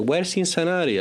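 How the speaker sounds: high hammed-up Belgian-Cuban accent; mock Belgian-Cuban accent. Father playing along in the car, mock Belgian-Cuban accent. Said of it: "in our new roles".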